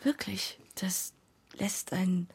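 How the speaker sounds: breathy whispered aside. Acted. Shoshana, touched by the game, whispers to the group. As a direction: let it fall to a whisper.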